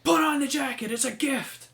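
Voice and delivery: loud, high exasperated yell; shouting. Retold or imitated. Jericho shouting at Sammy about the gifted jacket. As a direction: bellow the order at him. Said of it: "Chris Jericho just shouts at him".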